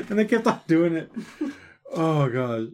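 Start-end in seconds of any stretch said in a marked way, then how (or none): none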